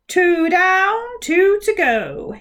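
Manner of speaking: loud, slow gloating intone; intoned. Acted. Giant mouth intones the score to the group. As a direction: chant the count like a victor. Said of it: "intoned the mouth".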